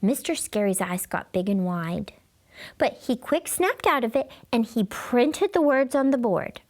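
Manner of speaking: ordinary delivery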